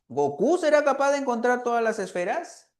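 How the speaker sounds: "¿Goku será capaz de encontrar todas las esferas?" asked with a high, rising lift on "será" and the end; rising yes-no question. Shown as a demonstration. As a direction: ask it as a clear yes-or-no question, voice climbing. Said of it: "solamente es ascendente cuando es una pregunta". (entonación ascendente de pregunta cerrada)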